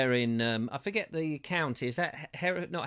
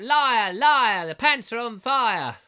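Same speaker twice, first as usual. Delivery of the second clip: loud, higher playground chant of liar; mock shout; 7 semitones higher, 8 dB louder, slower than usual. Host reading out a viewer's taunt, shouting it playfully. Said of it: "Glenn Johnson is shouting out".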